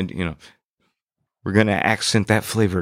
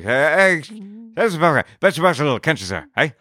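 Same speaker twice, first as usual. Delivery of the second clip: put-on high-pitched caricature Pikey accent; excitable mock Pikey brogue; 8 semitones higher, faster than usual. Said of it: "A little Pikey".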